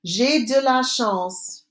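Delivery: J'ai de la chance said slowly again; slow repeat. Shown as a demonstration. Teacher repeating after Répétez, slow and clear. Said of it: "Répétez".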